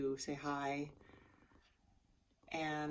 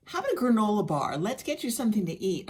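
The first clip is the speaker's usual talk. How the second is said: high, loud and fast fussing friend; higher, louder mock fussing; caring friend; 7 semitones higher, 8 dB louder, faster than usual. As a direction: imitate her quick, loud caretaking offer. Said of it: "she goes".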